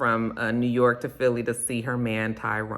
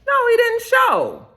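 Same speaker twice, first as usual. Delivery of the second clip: high shocked outburst, a bit louder; 5 dB louder, slower than usual. Retold or imitated. Friend hearing he never came, high shocked exclaim. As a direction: gasp it out in disbelief. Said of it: "And she was just like".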